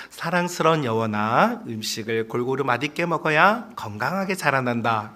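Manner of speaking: soft, low, unhurried coaxing; loving mother. Shown as a demonstration. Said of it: "일 번".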